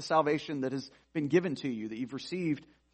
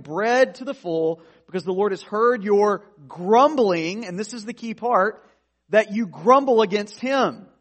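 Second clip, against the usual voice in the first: raised, loud pulpit delivery; fervent sermon voice, higher and louder; preaching at full force; 4 semitones higher, 7 dB louder than usual. Acted. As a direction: drive the scripture home to the congregation. Preaching Israel's grumbling at full force.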